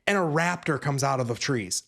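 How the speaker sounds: usual speaking voice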